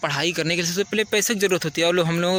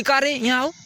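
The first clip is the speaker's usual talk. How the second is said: put-on a higher, careless "का रे" to a younger kid; rude call; 6 semitones higher than usual. Shown as a demonstration. Teacher shows students the rude way to call a kid. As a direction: bark it offhandedly like a rude village boy. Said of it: "उसको बोलोगे कि".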